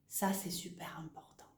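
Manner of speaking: whispered aside, unvoiced. Real, not acted. Teacher to student, sharing a secret tip, whispered. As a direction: lean in and whisper the key point. Teacher stressing key point.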